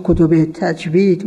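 normal talking voice

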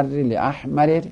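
slow لاحمرر with two separate r sounds; لاحمرر as "li-ahmarir"; unmerged form. Shown as a demonstration.

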